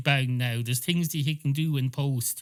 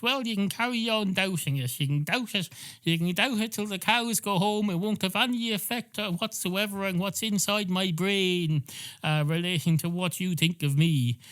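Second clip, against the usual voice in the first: lightly voiced smug cat; 7 semitones higher than usual. Cat character voice.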